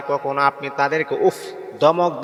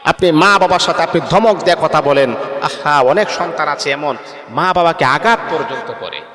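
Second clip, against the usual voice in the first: ordinary talk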